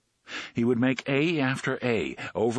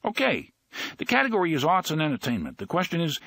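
quizmaster; 7 semitones higher, faster than usual. Acted. Quizmaster reading question.

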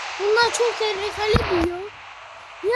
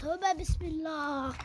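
the same tövbe bismillah, much softer, slow, nearly to himself; under breath; 4 semitones lower, 12 dB quieter, much slower than usual. Real, not acted. Gamer repeating it under his breath to himself, far softer and slow. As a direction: let the Tövbe fall to a breath.

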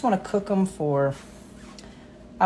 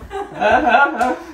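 laugh; 8 semitones higher, 8 dB louder than usual. Real, not acted. Laughing with grandmother on live.

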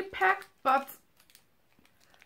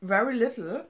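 chewing while talking; 3 semitones lower, 4 dB quieter than usual. Real, not acted. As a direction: mouth partly full. Seen 1: Bites chocolate just before; hand covers mouth while speaking after.

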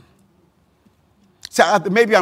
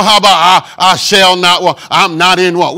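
louder bold proclamation; mock declaration; 2 semitones higher, 8 dB louder than usual. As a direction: assert it loudly to a doubter.

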